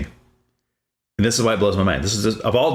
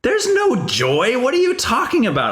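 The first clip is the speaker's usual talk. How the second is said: much higher, slow, disbelieving; sarcastic disbelief, voice pitched way up; incredulous inner retort; slower than usual. Retold or imitated. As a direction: scoff at the very idea of joy. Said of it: "and I'm thinking".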